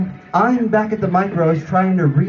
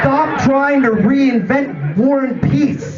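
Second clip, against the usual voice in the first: acted exasperated shouting, pitched high; pissed-off boss; 8 semitones higher, slower than usual. Jerry scolds the new helper over a burger order, pissed off. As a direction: bellow it in exasperation, building to a yell. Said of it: "he's pissed off because I'm still standing there".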